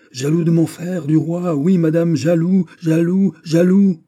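loud, high-pitched jealous tirade; jealous outburst. A narrator's reading. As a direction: hammer out each "jaloux", building to a shout. Prince bursts out in jealous quarrel.

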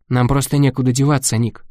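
usual speaking voice

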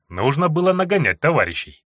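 plain reading voice

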